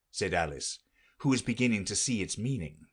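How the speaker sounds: ordinary delivery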